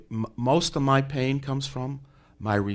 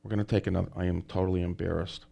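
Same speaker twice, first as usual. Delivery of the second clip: ordinary delivery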